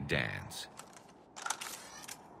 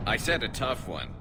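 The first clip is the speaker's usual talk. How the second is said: mock indignation; 9 semitones higher, 3 dB louder than usual. Mock complaint after an easy shot.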